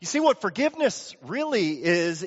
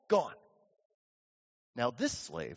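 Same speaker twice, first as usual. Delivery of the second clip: normal talking voice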